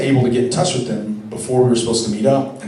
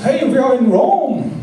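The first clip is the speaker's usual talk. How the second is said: mock German accent, much higher, louder and slow; 6 dB louder, slower than usual. Imitating the German couple's email in a hammed-up German accent. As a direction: ham up the German accent as a cheerful greeting. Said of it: "only it was with a German accent".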